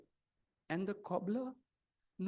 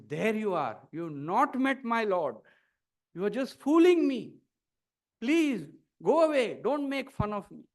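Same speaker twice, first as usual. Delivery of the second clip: angry dismissal; 2 semitones higher, 7 dB louder than usual. A narrator's reading. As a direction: send him away, offended and loud. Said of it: "The Brahmin is very angry".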